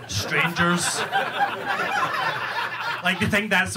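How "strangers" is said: ordinary talk, nothing marked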